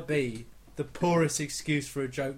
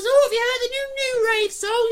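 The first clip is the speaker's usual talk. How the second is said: very high mock chatty hairdresser; 4 dB louder than usual. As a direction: squeal it like a gossipy hairdresser. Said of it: "I go to the bloody hairdressers".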